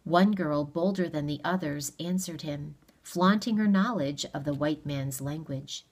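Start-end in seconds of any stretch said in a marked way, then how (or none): none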